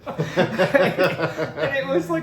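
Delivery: high, loud, laughing squeal; cracking up mid-sentence; laughing hard. Real, not acted. Laughing with his buddy about old times, high and loud.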